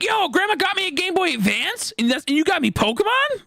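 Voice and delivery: very high, incredulous squeal; high-pitched, amazed question; excited child. Retold or imitated. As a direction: kid squeaking at mom in disbelief. Acts excited question to mom.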